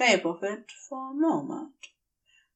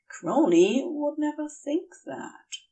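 plain reading voice

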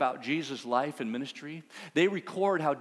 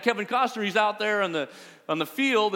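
normal talking voice

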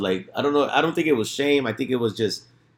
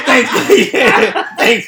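laughing thanks; 9 semitones higher, 8 dB louder, much slower than usual. Retold or imitated. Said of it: "I was like".

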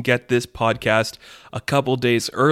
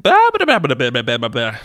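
minion talk; 6 dB louder, faster than usual. Acted. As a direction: babble like a cartoon minion. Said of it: "just random minion talk".

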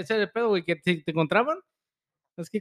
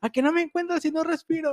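put-on taunting kid voice; 9 semitones higher than usual. Voicing the hiding boy taunting.

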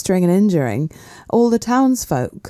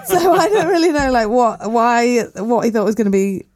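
higher, laughing, baffled squeak; weird high pitch; 5 semitones higher than usual. Real, not acted. Telling friends the myth, voice jumps up laughing. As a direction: pitch up in amused disbelief. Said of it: "Such a weird pitch".

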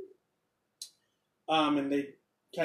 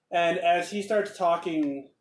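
normal talking voice

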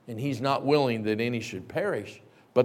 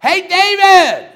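slow, loud high yell; father shouting for his son; calling out; 16 dB louder, slower than usual. Retold or imitated. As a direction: holler his name. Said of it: "I jumped up and I said".

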